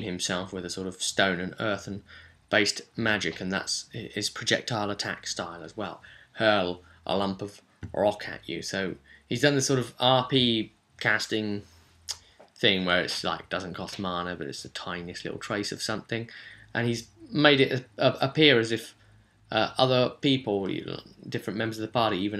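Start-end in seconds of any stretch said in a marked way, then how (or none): none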